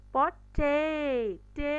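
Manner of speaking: পটে- with TE loud and very long, then টে alone. Shown as a demonstration. Teacher isolating the stressed syllable, long and loud. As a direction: punch and stretch টে, twice. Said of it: "এটা লঙ্গারও বলছি".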